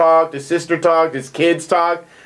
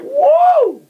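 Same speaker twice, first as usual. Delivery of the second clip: amazed; 4 dB louder than usual. Retold or imitated. Retelling reaction to leg stretch.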